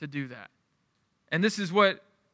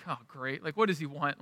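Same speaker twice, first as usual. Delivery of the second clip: weary exasperation; 3 semitones lower, 8 dB quieter than usual. Retold or imitated. Tired dad reacting to son's call.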